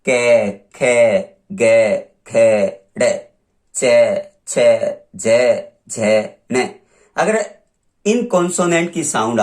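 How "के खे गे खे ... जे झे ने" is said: के, खे, गे, खे, डे, चे, छे, जे, झे, ने drawn out very slowly, each sound held and stressed; reciting consonant sounds. Shown as a demonstration. Teacher slowly sounding out consonants for students. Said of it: "consonant होता है".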